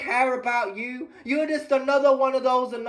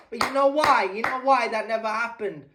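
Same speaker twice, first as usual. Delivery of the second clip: usual speaking voice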